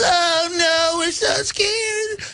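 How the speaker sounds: high, whimpering mock terror; mock scared whimper. Crowd mocked as cowering in a mock scared whimper. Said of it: "everybody writhes".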